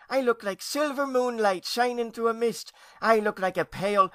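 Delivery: put-on high-pitched, self-admiring child voice; dreamy young ghost girl. A narrator's reading. Niece boasting of her fluffy look, high and dreamy.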